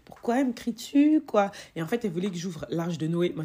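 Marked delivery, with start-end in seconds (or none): 0.1-1.5 high, loud baffled cry; incredulous outburst. Retold or imitated. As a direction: throw up your hands in disbelief. Exasperated inner reaction to yelling. (une femme sidérée qu'on lui crie dessus, éclat incrédule)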